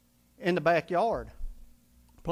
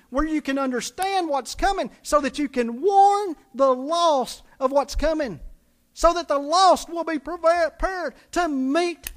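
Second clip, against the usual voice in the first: building, louder sermon delivery; full-force preaching; 5 semitones higher, 3 dB louder than usual. Acted. Pastor driving the point home to his congregation, preaching hard. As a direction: preach it with rising fire.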